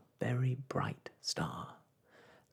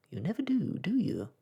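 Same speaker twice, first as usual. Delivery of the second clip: high, louder teasing sprite; teasing star voice; 9 semitones higher, 6 dB louder than usual. Acted. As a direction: tease with bright glee. Star teases Michael.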